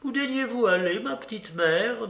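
high, deferential question; timid servant woman. A narrator's reading. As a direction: ask the mistress meekly in a high voice. Narrator voices the servant Marfa. (servante déférente)

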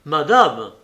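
agitated outburst. A narrator's reading. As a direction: cut in forcefully. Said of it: "avec une agitation".

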